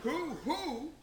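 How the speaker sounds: high, playful hoot; owl-like hooting. Acted. Answer turned into an owl-like hoot.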